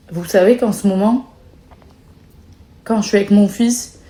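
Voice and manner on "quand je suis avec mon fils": ordinary talk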